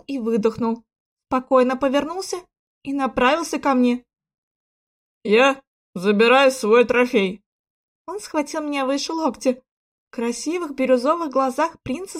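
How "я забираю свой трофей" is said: slow, weighty declaration; measured possessive command; commanding claim. A narrator's reading. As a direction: claim it slowly and firmly. Prince coldly claims his prize.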